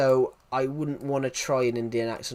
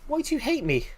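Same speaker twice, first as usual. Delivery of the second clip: mock hurt; 11 semitones higher than usual.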